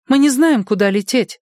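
lightly voiced young friend; young woman voice. A narrator's reading. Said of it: "сказала А А".

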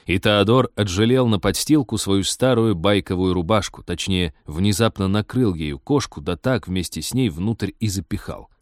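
ordinary delivery

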